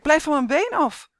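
high, loud shout at the dogs; boy calling out. A narrator's reading. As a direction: shove the dogs off with your voice. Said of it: "roept Henrik".

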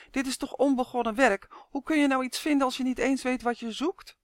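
grumbling. A narrator's reading. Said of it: "mopperde hij". (mopperend, met hogere stem)

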